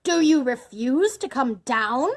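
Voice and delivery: enraged villain's challenge; angry roar. A narrator's reading. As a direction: roar it up the stairs. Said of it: "roared Bluebeard".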